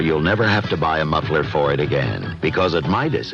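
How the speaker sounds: ordinary talk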